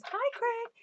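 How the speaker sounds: high greeting. Retold or imitated. Reading viewer's greeting to husband.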